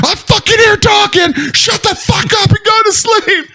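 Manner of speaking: very high, strained shout; drill instructor yelling. Acted. Instructor in the hut ordering recruits to sleep, strained shout. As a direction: yell it like an order across the barracks.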